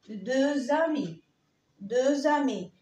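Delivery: "دو زامي" slowly, x linked as z; liaison with z. Shown as a demonstration. Teacher demonstrating two friends in French, linking z. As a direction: join "دو" to "زامي" with a voiced z. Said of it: "لو جه بعديها لتر فويل بنطقها ز".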